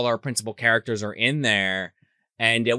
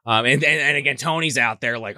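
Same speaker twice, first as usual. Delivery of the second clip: normal talking voice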